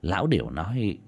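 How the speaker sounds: ordinary delivery, nothing marked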